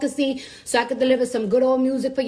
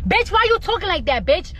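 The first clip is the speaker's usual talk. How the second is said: higher, indignant call-out; acted indignation; 5 semitones higher, 3 dB louder than usual. Confronting a friend over her fake voice, mock indignant.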